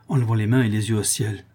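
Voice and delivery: usual speaking voice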